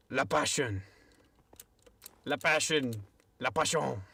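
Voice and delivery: playful mock French accent repeating La passion. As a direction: say La passion like a French person, hamming it up. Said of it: "I'm gonna say it as a French person".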